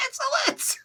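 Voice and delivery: shrieking very high; hammed-up furious alien cry; mock screaming. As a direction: scream insolent in outrage. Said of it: "They're literally screaming".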